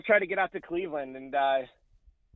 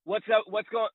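radio promo voice; 7 semitones higher, 3 dB louder than usual. Shown as a demonstration. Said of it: "just cut a promo I D".

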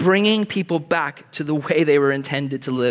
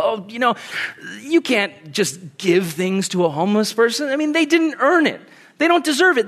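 high-pitched grumbling objector; mock indignant objecting; 6 semitones higher than usual. Objector arguing against helping homeless, mock indignant and high. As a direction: rattle off objections in a raised pitch.

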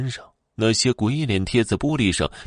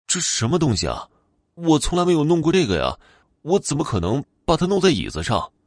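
alarmed protest; 8 semitones higher, faster than usual. Acted. Wang Qi accused, protesting innocence.

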